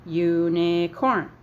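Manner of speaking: "Unicorn" very slow, in three beats, u-ni-corn; syllable by syllable. Shown as a demonstration. Teacher shows the three sounds in Unicorn, syllable by syllable. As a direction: stretch Unicorn out so each syllable can be counted. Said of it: "Three sounds".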